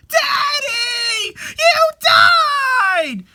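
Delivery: put-on child sobbing and screaming from a nightmare; a loud, high wail of terror and grief; crying out. Small girl wakes screaming for her dead father.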